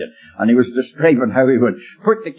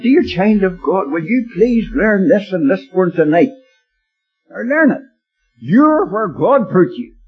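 slow, higher-pitched, pleading exhortation; earnest preaching; 8 semitones higher than usual. Real, not acted. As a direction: urge each listener, drawing words out. Preacher exhorts the congregation directly.

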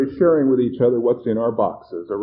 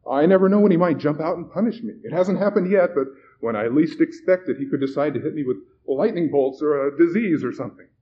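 fearful; 5 semitones higher, 4 dB louder than usual. Acted. Terrified man fears box.

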